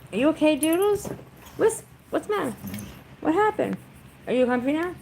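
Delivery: high, loud pet-talk. Real, not acted. Talking to dog.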